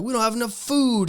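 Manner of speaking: high, anxious whining complaint; worried disciples. Retold or imitated. Preacher imitating worried disciples, anxious high whine.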